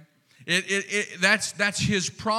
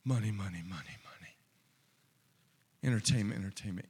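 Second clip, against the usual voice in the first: fading craving; 8 semitones lower, 8 dB quieter, much faster than usual. Acted. Preacher voicing worldly craving.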